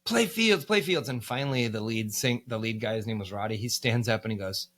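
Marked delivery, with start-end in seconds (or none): 0.0-1.1 high, loud, repeated demand; insistent shout. Retold or imitated. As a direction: keep pushing the request, louder. Tourists berating the musicians.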